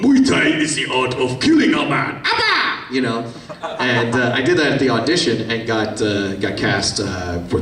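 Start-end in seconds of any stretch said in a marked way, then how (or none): 0.0-2.2 lightly voiced foreign old master; a hint of a stern martial arts master; Appa Chai voice. Shown as a demonstration. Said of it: "if he talked like this". 2.2-2.9 sudden very high squeaky yelp; Appa Chai nervous tic. Shown as a demonstration. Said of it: "slip into Appa as, like, a nervous tick".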